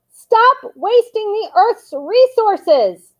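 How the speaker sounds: high, slow protest yell; yelled slogan. A narrator's reading. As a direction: call it out across the square. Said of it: "they yelled".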